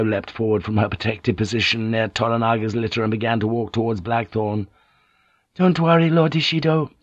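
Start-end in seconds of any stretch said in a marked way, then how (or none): 5.5-6.9 high, raised-voice plea; crying out. A narrator's reading. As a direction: call out high to reassure a lord. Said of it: "she cried out".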